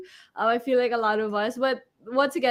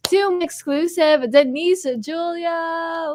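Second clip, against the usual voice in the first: hyped announcer intro; 6 semitones higher, much slower than usual. Real, not acted. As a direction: stretch the name like a hype host. Host announces guest.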